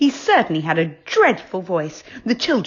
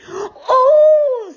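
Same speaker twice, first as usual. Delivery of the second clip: delighted gasp; 8 dB louder than usual. A narrator's reading. Franny delighted.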